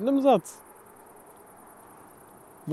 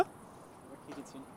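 breathy slow whisper; 5 semitones lower, 21 dB quieter, much slower than usual. Pretend. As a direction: whisper it slowly. Whispered echo.

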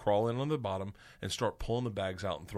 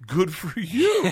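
slow, loud, high mock praise; joking congratulations; 8 semitones higher, 7 dB louder, much slower than usual. Classmate John teases the new learner, joking congratulations. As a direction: stretch it out as teasing applause. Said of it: "like, as a joke".

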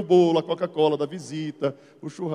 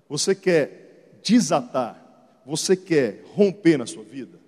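slow, weighty appeal; preaching appeal; 5 semitones lower, slower than usual. Acted. Bishop appealing to his congregation, slow preaching. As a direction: call the church to respond.

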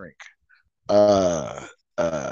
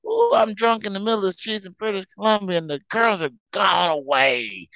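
put-on drunk voice; 9 semitones higher than usual. Drunk lament in Canada.

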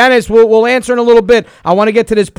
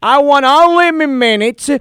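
prank caller in a thick put-on Italian accent; 3 semitones higher than usual. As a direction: play an Italian immigrant dad, hammed-up. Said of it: "but I got a lot of accent".

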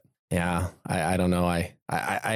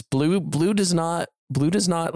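"does not" stretched slow and higher; insistent; 7 semitones higher, slower than usual. Real, not acted. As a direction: lean hard on "does not", slowly. Insisting the dinosaur won't care.

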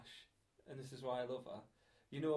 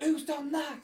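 much higher, louder imitated shriek; mock shriek; 13 semitones higher, 12 dB louder, slower than usual. Girlfriend finds the toilet, imitated high loud shriek. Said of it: "she shrieks".

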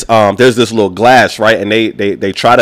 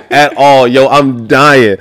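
ordinary delivery

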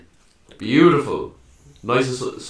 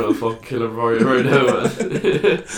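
laughing mock threat; 2 semitones higher, 7 dB louder than usual.

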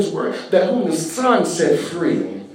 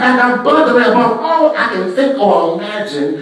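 loud, rising preacher's cry, building; preaching at full force; 4 semitones higher, 4 dB louder than usual. Acted. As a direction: shout the freedom out to the church.

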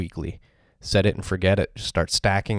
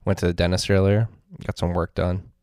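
usual speaking voice

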